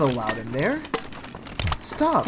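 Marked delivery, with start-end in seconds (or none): 1.8-2.3 high, sharp command; sharp stop. Real, not acted. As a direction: order it to stop. Scolding villager in game.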